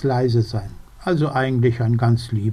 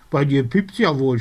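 mild scolding. Acted. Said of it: "schimpft Socke".

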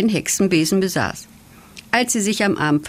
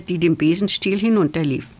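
ordinary delivery, nothing marked

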